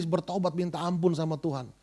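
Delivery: ordinary delivery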